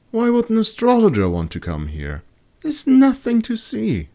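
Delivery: put-on high, curious young woman's voice; wondering aloud at the empty sky; Kat's voice. A narrator's reading. Kat gazing upward, puzzled and high-voiced.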